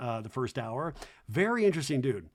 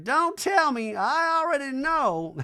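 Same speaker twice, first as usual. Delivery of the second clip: lofty slow mock-mom rant; 11 semitones higher, 4 dB louder, slower than usual. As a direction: drone it like a grand old dame. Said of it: "And she's like".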